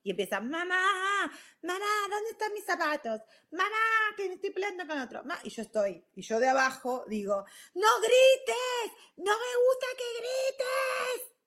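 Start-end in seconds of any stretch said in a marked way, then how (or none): 0.4-5.4 high-pitched imitation of whiny kids; high, stretched mock kid call; child calling Mom. 7.7-11.3 very high, drawn-out screech; hammed-up shrieking mom; mock parental yell.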